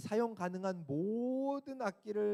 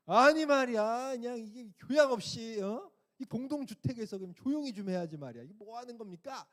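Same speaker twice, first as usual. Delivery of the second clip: loud, higher mock scolding of the neighbour; retold angry snapping; 7 semitones higher, 6 dB louder than usual. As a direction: replay the rude lecture on the phone, loud and high. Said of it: "막 쏘아붙였습니다". (이웃에게 전화로 큰 소리로 쏘아붙이는 흉내)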